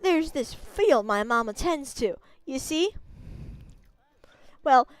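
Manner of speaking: brash villager. Acted. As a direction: scoff at the little wolf. New villager character voice.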